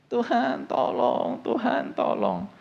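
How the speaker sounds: high, slow desperate plea to God; pleading cry for help. Acted. Preacher voicing Israelites crying out to God, high slow plea. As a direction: beg God slowly as an oppressed slave. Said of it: "ada yang lagi ngalamin kayak gitu ya".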